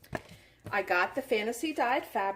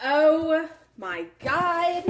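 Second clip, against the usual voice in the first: awed; 5 semitones higher, 7 dB louder, much slower than usual. Real, not acted. Awed reaction to gift.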